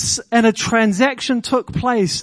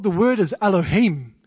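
normal talking voice

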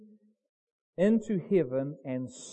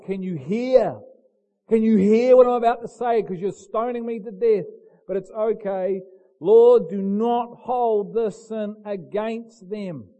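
loud, higher plea, slowing into the prayer; crying out loudly; 5 semitones higher, 9 dB louder than usual. Acted. Dying Stephen calls out to those stoning him, crying out loudly. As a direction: project it so the crowd can hear. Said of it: "He cries out with a loud voice".

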